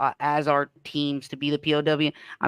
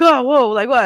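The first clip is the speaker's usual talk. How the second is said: higher, louder startled reaction; imitated scare; 7 semitones higher, 6 dB louder than usual. Players react to his finger gun, mock scared. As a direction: act out people caught off guard. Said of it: "They got scared".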